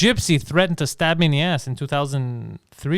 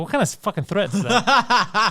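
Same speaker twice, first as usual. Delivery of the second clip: laughing disbelief; 6 semitones higher, slower than usual. Retold or imitated.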